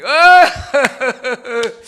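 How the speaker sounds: loud, high burst of belly laughter; big laugh. Real, not acted. 설교자가 농담 뒤에 웃음. (크게 터뜨리는 웃음)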